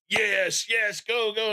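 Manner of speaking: retold cheering. Fan re-enacts cheering a Bills game, mock excited cheer. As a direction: imitate yourself cheering the game on. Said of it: "and I'm screaming, I'm cheering".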